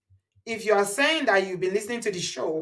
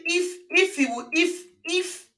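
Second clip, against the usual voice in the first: mocking; 7 semitones higher, slower than usual. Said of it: "And you keep saying".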